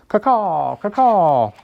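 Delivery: high, loud cawing crow. Acted. The crow answers the bear with a loud high caw.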